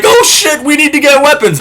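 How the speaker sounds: very high, frantic cry; mock panic. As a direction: shriek like a helpless suitor. Suitors panicking.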